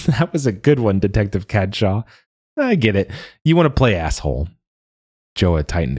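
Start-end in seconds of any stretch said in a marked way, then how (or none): 0.1-4.5 higher, amused, teasing; giggly teasing. A narrator's reading. Mila teasing Joa, amused and giggly. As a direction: tease him through a giggle. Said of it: "she snorted with giggles".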